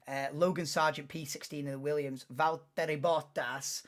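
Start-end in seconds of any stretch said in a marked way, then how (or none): 2.3-3.8 put-on slow, drawn-out Finnish accent on the name. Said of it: "I can't say his name without doing it in that accent".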